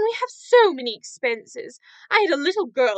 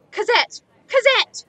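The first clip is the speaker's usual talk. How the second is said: shrill, much higher call, rising on the second; 8 semitones higher, 5 dB louder than usual. Acted. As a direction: yell the name across the inn. Innkeeper calls the girl from afar.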